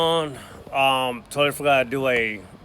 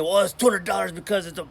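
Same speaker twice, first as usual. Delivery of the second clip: higher, curt dismissive answer; mock rude contractor; 7 semitones higher than usual. Showing viewers the wrong way to answer, mock curt. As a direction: play the rude guy you shouldn't be. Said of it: "Don't be mean".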